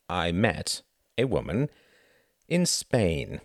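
measured, with a pause mid-sentence; slowly. Acted. Son explains his early return to mother, slowly. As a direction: parcel it out phrase by phrase. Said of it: "he said slowly".

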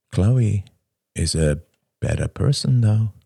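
ordinary delivery, nothing marked

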